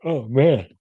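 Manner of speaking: mid-yawn, stretched out. Real, not acted. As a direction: yawn through the words. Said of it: "freaking yawning like crazy".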